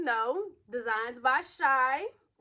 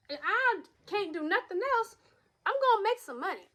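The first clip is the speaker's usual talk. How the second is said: emphatic; 8 semitones higher, 5 dB louder than usual. Real, not acted. Emphatic money point.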